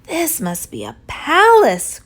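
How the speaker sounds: mild awe, as if seeing a palace; understated amazed gasp; gasping in wonder. Acted. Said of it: "Corduroy gasped".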